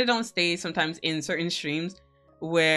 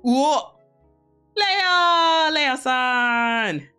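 loud, high, excited greeting; 8 semitones higher, 5 dB louder, much slower than usual. Real, not acted. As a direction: yell a happy hello.

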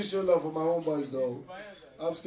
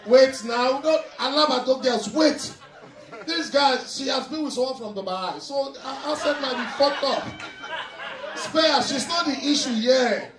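raised, high-pitched comic outburst; loud protest; 8 semitones higher, 6 dB louder than usual. Real, not acted. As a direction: shout over the crowd in protest. Comic protest over a noisy crowd.

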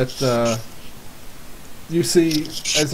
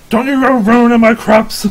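put-on shouted old farmer's warning, higher and much louder; angry old man; 8 semitones higher, 9 dB louder than usual. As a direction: yell it like an old man guarding his land. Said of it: "the old man kinda, like, banging on the window".

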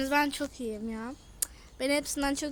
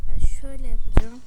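sudden loud decision; 3 semitones lower, 16 dB louder than usual. Real, not acted.

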